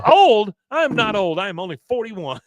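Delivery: high, loud mock indignation; offended outburst. Woman just called old, high indignant protest easing in volume. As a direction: act out wounded vanity, starting loud and high.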